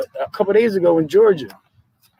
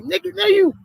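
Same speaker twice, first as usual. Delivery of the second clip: high, disbelieving exclamation at the cops; incredulous outburst; 13 semitones higher than usual. Retold or imitated. Friend retelling the beating video, rising in outrage.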